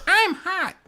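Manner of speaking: high squeaky teddy bear voice; squeaky toy voice. Pretend. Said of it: "That's a nice voice".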